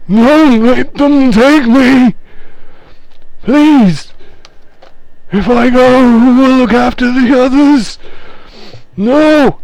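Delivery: cling to them, terrified of being taken; frantic spirit pleading. Acted. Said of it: "and goes".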